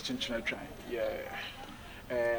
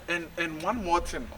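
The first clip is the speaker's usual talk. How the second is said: ordinary talk, nothing marked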